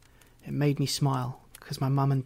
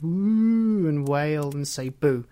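mock ghostly wail; 7 dB louder, much slower than usual. A narrator's reading. Ghost trying to scare him, wailing long and loud. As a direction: draw out a spooky wail, rising and swooping. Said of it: "I tried to scare him".

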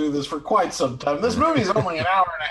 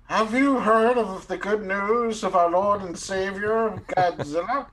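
slow, higher, earnest preacher; slow and solemn, like a door-knocking preacher; evangelist pitch; 6 semitones higher than usual. Pretend.

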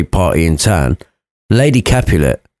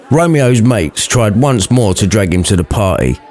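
normal talking voice

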